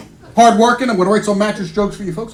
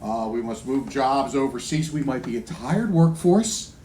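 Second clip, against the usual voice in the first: corporate boss; 9 semitones lower, 4 dB quieter than usual. Retold or imitated. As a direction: imitate a ponderous company man. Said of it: "They say".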